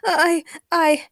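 high, loud, halting start; stammering. A narrator's reading. Peter is caught out by Daniel, stammering. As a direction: stumble on the word, flustered. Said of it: "Peter stammered".

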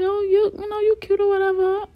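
high, coy little voice; acted shy compliment in a high voice; coyly flirting. Retold flirting with new man.

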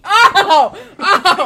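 very loud high "oh!"; sudden piercing cry of protest; ear-splitting yelp. Real, not acted. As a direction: yelp at full volume. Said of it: "You broke the sound barrier".

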